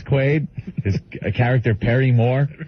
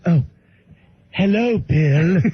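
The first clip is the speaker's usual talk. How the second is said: slow, higher, mock-polite greeting; horrified parents; 4 semitones higher, slower than usual. Horrified parents greeting their son's guest, mock-polite and drawn out. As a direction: imitate uneasy parents drawing out a greeting. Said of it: "They're horrified by him".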